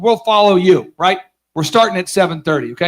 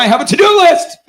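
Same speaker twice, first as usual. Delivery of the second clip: higher-pitched, exasperated self-righteous retort; indignant boast; 9 semitones higher than usual. Retold or imitated. Organized member scolding forgetful group members, indignant. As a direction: burst out with it, as if it's obvious.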